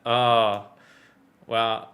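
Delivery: ordinary talk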